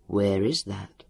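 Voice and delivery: low, controlled question; calm interrogation. A narrator's reading. Elio questioning captive Leon, calm and low. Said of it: "Elio's voice rang up".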